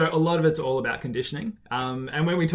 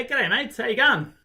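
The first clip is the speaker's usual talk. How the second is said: Aussie greeting; 10 semitones higher, 5 dB louder than usual. Real, not acted. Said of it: "Love the accent".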